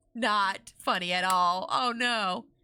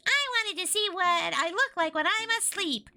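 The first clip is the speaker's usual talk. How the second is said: squeaky, high puppet voice; high-pitched squeaky dummy; 10 semitones higher than usual. A narrator's reading. The dummy telling its joke, high and squeaky. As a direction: deliver the punchline as a squeaky dummy. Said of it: "in a high-pitched, squeaky voice".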